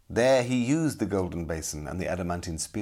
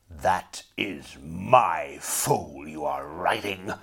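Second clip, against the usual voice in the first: slow, menacing whisper; sinister sorcerer; 2 semitones lower, much slower than usual. A narrator's reading. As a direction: threaten slowly under the breath. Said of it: "roared Sir Rogier".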